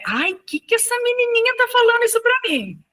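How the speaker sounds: high, astonished put-on voice; incredulous inner voice. Teacher voicing her astonished thought at the child's words. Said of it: "fica assim, um misto".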